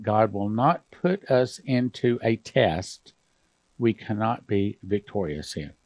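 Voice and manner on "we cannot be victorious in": normal talking voice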